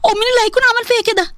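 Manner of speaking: high, panicky lament; panicked. Acted. Girl alone after seeing the video, high-pitched panic.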